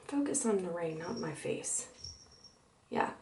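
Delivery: very soft, low instruction; real under-the-breath direction; hushed aside. Real, not acted. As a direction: murmur it off to the camera operator. Aside to whoever holds the camera.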